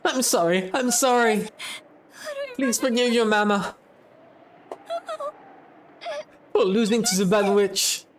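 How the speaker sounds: high, crying apology; tearful begging. Acted. Mother crying into her daughter's chest, begging forgiveness tearfully. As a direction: sob out the plea for forgiveness.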